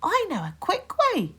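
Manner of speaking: put-on high bright chirpy girl; little girl chirping; chirped. A narrator's reading. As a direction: chirp it cheekily. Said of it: "chirped Katie Morag".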